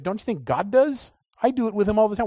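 ordinary talk

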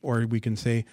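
ordinary delivery

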